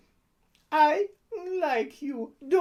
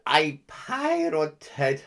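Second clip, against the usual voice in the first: ordinary delivery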